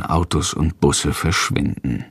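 ordinary delivery, nothing marked

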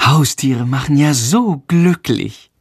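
slow, poisonous sarcastic jab; venomous sarcasm. A narrator's reading. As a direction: needle him with sarcasm. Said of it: "giftet sie ihn an".

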